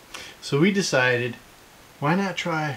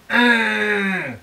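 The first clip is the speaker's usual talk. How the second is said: mild mock stadium yell, higher; imitating fan's yell; 6 semitones higher, 5 dB louder than usual. Dad describing a fan at Revolution games, mock yell. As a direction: bellow like the guy in the stands. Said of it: "and he just goes".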